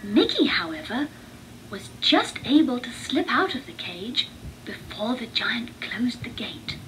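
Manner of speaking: plain reading voice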